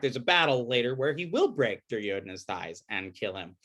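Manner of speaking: ordinary delivery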